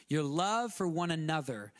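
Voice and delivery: usual speaking voice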